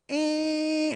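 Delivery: mock Dumb and Dumber whine ending in a high eh; Jim Carrey annoying sound. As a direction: lightly imitate the grating movie noise. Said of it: "Jim Carrey and Dumb and Dumber going".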